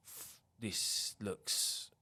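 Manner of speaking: slow whisper; whispered thought. Retold or imitated. Teller recalling entering the hoarder's house, slow whisper. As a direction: breathe it out slowly under your breath.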